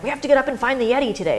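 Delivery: put-on rally the kids like an eager dad; pushing the kids out of the tent, fast and eager. Dad rousing kids on Everest.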